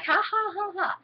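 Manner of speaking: high, mocking fake laugh; a teasing cartoon "ha ha".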